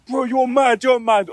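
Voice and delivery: high, loud, hyped-up friends. Retold or imitated. Quoting his mates hyping him after the shot, loud and excited.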